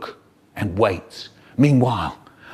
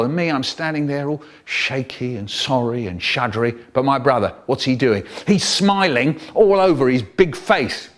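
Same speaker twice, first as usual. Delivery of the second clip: plain reading voice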